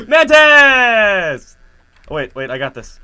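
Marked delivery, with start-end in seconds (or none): 0.1-1.6 high, drawn-out shout; sudden yell. Real, not acted. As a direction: yell it out loud and long. Sudden yell during gameplay.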